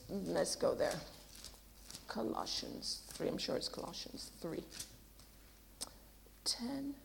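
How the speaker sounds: very quiet, low murmur, barely voiced; murmuring to oneself. Real, not acted. Preacher hunting for a verse, muttering to self. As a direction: mumble it under your breath while searching. Checking the reference to herself.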